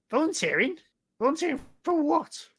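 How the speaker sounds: very high-pitched, incredulous goblin squawk; alarmed goblin. Acted. Goblin learns he has been volunteered, high-pitched alarm.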